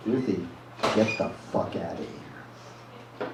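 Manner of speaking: slow, low and menacing, nearly whispered; low, threatening voice. Retold or imitated. Telling Ruthie to get out of the bar, low and menacing. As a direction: threaten her under your breath. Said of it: "I say in a low, threatening voice".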